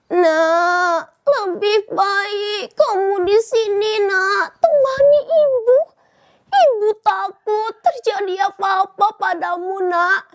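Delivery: mother's voice cracking with tears, rising on the plea; crying mother begging her son not to leave; pleading tearful mother. Acted. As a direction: beg him to stay, near crying.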